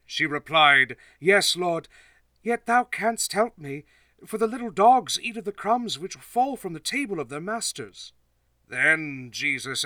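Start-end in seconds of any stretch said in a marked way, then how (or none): 1.2-8.1 higher, earnest pleading woman; persistent plea. Acted. Canaanite woman replies to Jesus in a high earnest plea. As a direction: press the plea without giving up.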